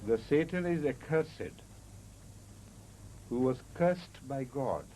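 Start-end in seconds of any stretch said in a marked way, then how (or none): 0.9-1.5 "accursed" in three syllables, uh-KUR-sid; adjective pronunciation. Shown as a demonstration. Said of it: "you understand the difference". 3.8-4.3 "cursed" in one syllable, KURST; verb pronunciation. Shown as a demonstration. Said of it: "you understand the difference".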